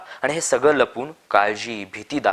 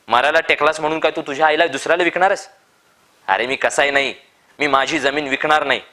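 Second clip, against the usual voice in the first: higher, louder angry outburst; furious refusal; 5 semitones higher, 4 dB louder than usual. A narrator's reading. Old Appa refusing to sell his land, furious higher shout. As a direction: hurl the refusal at them.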